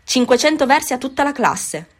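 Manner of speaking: high, loud furious outburst; furious teacher. A narrator's reading. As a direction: blast the whole class with the punishment. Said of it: "esclamò l'insegnante con voce furibonda". (il professore punisce la classe con voce furibonda)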